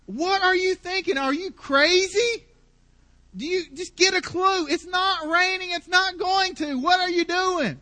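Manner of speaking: high, loud, fed-up yelling at the master; exasperated servant. Retold or imitated. Servant sent to look for rain the seventh time, exasperated shouting. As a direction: blow up like a servant sent out one time too many. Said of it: "that's my impression of the servant".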